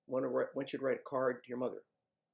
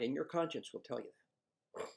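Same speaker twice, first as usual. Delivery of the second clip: normal talking voice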